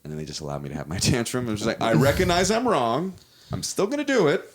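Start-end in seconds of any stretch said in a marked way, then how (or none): 1.8-4.5 high, exaggerated mock confession, owning it proudly; defiant self-mockery. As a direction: play the stubborn brat who knows he's wrong. Self-aware mock confession quote.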